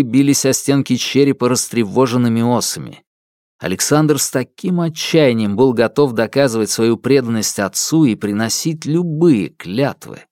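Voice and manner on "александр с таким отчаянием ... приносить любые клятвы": usual speaking voice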